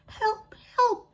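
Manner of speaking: very high, tiny squeaky voice; mouse squeaking. A narrator's reading. The caught mouse squeaks for help, very high and tiny. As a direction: squeak like a terrified little mouse. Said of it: "Lad Luggins squeaked".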